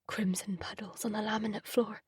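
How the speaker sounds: unvoiced memory whisper; haunted whisper. A narrator's reading. Narrator recalling his mother's murder, haunted whisper. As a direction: whisper the horror.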